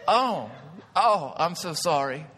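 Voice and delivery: slow, drawn-out acted apology; mock apology. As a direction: play sheepish sympathy to a squeaky-voiced giant. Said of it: "Like, I'm like".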